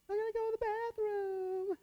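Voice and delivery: high, soft whining student voice; whiny kid. Retold or imitated. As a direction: plead like a kid who needs the bathroom. Student interrupting a teacher.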